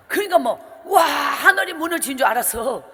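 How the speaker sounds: ordinary delivery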